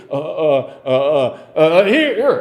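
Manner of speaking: flustered stammering. Retold or imitated. Andrew voiced scrambling.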